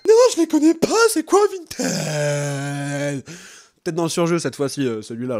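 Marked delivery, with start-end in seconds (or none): 0.1-3.2 high then slow dragged question; overacting character. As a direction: ham up a clueless guy. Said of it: "le perso il est dans le surjeu".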